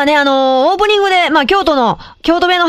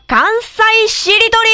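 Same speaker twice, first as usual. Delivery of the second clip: title call; 7 semitones higher, slower than usual. Acted. Announcing the corner title.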